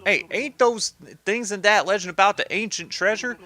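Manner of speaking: cartoon gangster sidekick; mob henchman. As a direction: pipe up as a curious underling. Voices an eager henchman.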